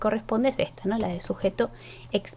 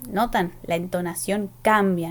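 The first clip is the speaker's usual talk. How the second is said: ordinary delivery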